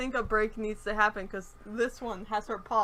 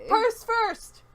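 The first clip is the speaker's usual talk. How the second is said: high, loud mock-diva call; fancy diva; 13 semitones higher, 8 dB louder than usual. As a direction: announce it like a fancy diva, pitched way up. Voicing the fancy dog's attitude.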